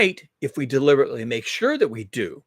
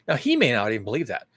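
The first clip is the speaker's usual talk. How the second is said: ordinary talk, nothing marked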